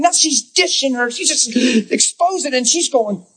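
high, tumbling gossipy rush of words; excited retelling. Retold or imitated. Pastor imitating a friend who spills the news, excited and high. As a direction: mimic a friend spilling everything, pitched high.

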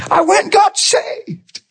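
very high, slow squeal of joy; retold elation, drawn out way up high; elated announcement. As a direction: stretch it out, overjoyed and high. Acts out coworker's conversion news.

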